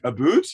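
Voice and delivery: "boot" in exaggerated Canadian for "about"; Canadian pronunciation. Shown as a demonstration. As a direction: stretch "a boot" slowly, with a long oo. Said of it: "say the very specific Canadian words".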